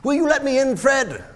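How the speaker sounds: high, loud, hopeful request; eager calling out. Acted. Uncle Scrooge asking to join dinner, eager loud call. Scrooge calls to his nephew.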